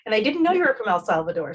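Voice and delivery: ordinary delivery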